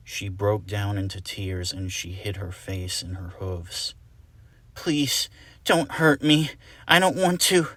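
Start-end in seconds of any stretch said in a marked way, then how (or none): 4.7-7.7 high, loud tearful begging; frightened sobbing plea; scared pleading scream. Acted. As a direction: plead not to be hurt. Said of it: "she screamed".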